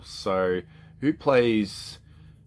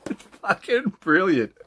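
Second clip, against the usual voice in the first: laughing; 10 semitones higher, 3 dB louder than usual. Real, not acted. Still laughing after story.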